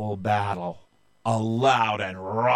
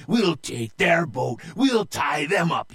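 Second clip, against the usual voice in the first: raised, rousing captain's shout; higher, punchy war cry; pirate roar; 6 semitones higher, 4 dB louder than usual. Acted. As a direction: roar the battle plan at the crew. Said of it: "he roared".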